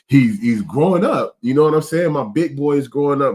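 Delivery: high, loud, choked-up pride; a proud dad close to real tears; tearing up. Real, not acted. Father talking about his son growing up, on the edge of tears. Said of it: "I'm about to start crying right now".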